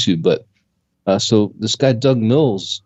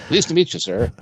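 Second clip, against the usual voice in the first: higher, polite nerdy voice; prim, nerdy greeting; 7 semitones higher than usual. Retold or imitated. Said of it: "He has a nerdy voice all of a sudden".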